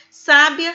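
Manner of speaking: "sábia" with the stress on SÁ; stress on first syllable. Shown as a demonstration. Teacher contrasting lookalike words, "sábia" stressed on SÁ. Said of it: "diferença é a sílaba tônica".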